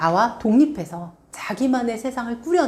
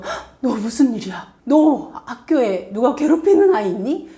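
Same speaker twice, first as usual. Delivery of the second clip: mock-alarmed mother, slightly louder; alarmed worried parent; 3 semitones higher, 4 dB louder than usual. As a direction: play the overanxious parent who panics at once. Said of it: "굉장히 깊게 딥하게 들어가는". (깜짝 놀라 걱정하는 엄마 흉내)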